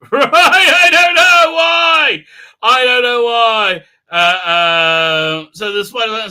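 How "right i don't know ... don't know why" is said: high, loud laughing disbelief. Real, not acted. Mocking the silly plot, laughing. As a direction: laugh it out slowly.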